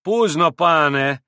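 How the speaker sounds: drawn-out, grudging reply; reluctant. A narrator's reading. Pole on the sofa answers Mitya, slow and reluctant. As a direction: stretch it as if unwilling. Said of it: "как бы нехотя отозвался пан на диване".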